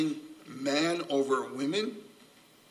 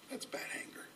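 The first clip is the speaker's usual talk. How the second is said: whispered verdict; 18 dB quieter, faster than usual. Acted. Preacher whispering a verdict to the congregation. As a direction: hush it to a whisper.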